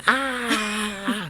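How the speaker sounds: high, soft mock scream. Recalling the screaming girl on that show, mock scream. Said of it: "'Cause she's screaming".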